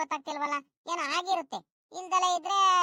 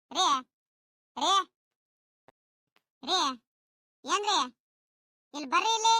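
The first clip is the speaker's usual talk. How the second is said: calling out. Acted. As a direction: call out repeatedly to someone out of sight. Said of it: "ಎಷ್ಟ್ ಸಲ ರೀ ಕೂಗಾಡೋದು". (ದೂರದವರನ್ನು ಕೂಗಿ ಕರೆಯುವುದು)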